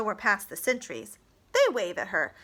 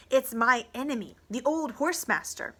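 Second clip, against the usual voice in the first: ordinary delivery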